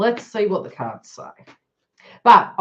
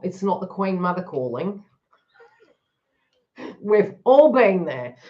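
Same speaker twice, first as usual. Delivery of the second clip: ordinary talk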